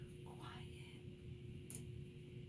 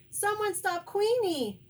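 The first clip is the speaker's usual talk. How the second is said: high, raised call for help; crying out; 10 semitones higher, 5 dB louder than usual. A narrator's reading. Quail calls for help against thieving dog, crying out. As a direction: call urgently for someone to help. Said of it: "cries Quincy".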